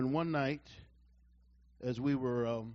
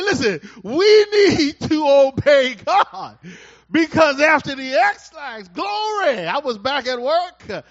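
high, loud, laughing and crying praise; full-force preaching; 7 semitones higher, 12 dB louder, slower than usual. Real, not acted. Preacher stirs the church, loud, laughing and crying. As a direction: shout it out to the congregation in joy.

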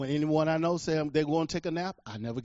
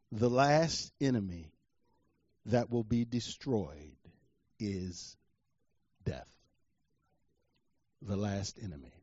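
low, soft, with long pauses; solemn scripture reading; 8 semitones lower, 7 dB quieter than usual. Real, not acted. Preacher closes on the last enemy, low and solemn. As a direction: let each phrase fall quietly into silence.

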